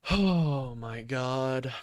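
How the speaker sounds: long drawn-out exasperated groan of words; real exasperation, very slow; dismayed. Real, not acted. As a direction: stretch it out in slow disbelief. Dismayed reaction to the capitulation.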